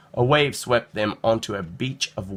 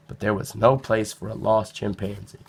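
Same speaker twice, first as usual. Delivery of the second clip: ordinary delivery, nothing marked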